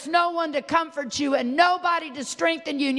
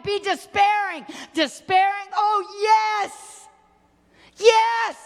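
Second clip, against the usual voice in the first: high, urgent sermon cry; impassioned preaching; 4 semitones higher than usual. Real, not acted. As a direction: press the questions out at preaching pitch.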